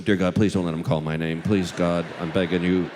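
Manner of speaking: low, loud mock prayer; religious pleading. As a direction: beg God in a deep, urgent voice. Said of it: "everybody gets really religious".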